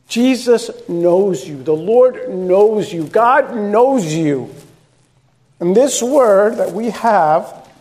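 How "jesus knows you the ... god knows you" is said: slow emphatic refrain. Acted. Building refrain.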